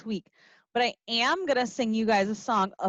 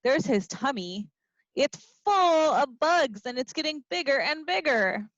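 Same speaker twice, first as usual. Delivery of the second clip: ordinary delivery, nothing marked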